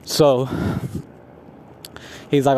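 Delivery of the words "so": ordinary talk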